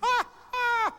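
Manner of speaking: very high, loud "ah"; two piercing imitated cries; audience's mock outcry. Comedian imitating the public's reaction, high loud cries. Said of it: "Alors le public".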